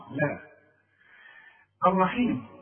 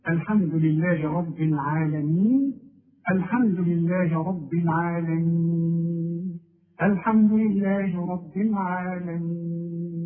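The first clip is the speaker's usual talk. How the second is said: العالمين with the long vowel held for two beats and more; drawn-out ending; 3 semitones higher, 4 dB louder, slower than usual. Shown as a demonstration. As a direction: stretch the ي of العالمين out. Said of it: "ممكن تقول". (مد العالمين حركتين وأربعة)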